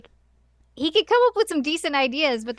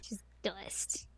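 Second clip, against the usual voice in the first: unvoiced hushed whisper; 5 semitones lower, 18 dB quieter than usual. Real, not acted. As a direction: breathe it like a grim secret. Dramatic whispered end.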